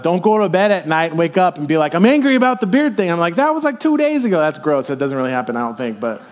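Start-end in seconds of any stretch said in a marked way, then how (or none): 1.9-3.1 mock wife complaint. Wife voiced waking up angry. 3.4-4.4 exasperated reply. Retold or imitated.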